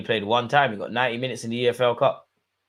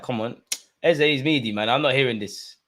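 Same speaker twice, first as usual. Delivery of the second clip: ordinary talk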